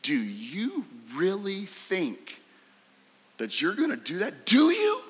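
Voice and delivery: doubt him openly, then needle him; mocking brother's challenge; emphatic disbelief. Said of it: "it's emphatic".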